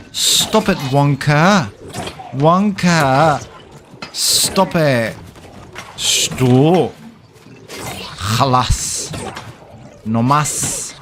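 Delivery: yelling. Real, not acted. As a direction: shout for them to stop. Yelling at character in fight.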